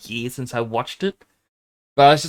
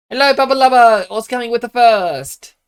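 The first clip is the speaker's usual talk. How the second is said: put-on high, loud baby-talk greeting; squeaky high hello to the bunny; cute pet voice; 12 semitones higher, 7 dB louder than usual. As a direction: call up high and bright to the pet.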